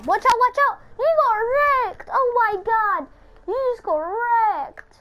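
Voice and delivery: gamer crowing over a kill in chat, high excited taunt; high-pitched gloating shout, drawing out the words. Real, not acted. Gamer taunting a wrecked player, excited high crowing.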